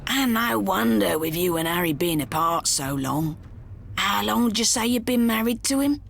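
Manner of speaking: lightly voiced rough driver; a hint of a cheerful thug; pockmarked kidnapper. A narrator's reading. Kidnapper greets Millie in the car, lightly voiced thug.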